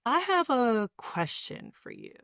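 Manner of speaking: low soft deliberate tone; low, soft and slow. Shown as a demonstration. Coaching a partner on auditory appeal, low soft slow voice. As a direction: let them hang on each word. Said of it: "those low, soft, deep tones".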